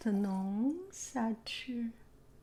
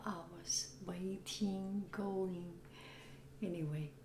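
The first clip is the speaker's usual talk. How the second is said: softer raspy whisper, voice gone; 8 dB quieter than usual. Real, not acted. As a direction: breathe the words, no voice. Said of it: "I have no voice".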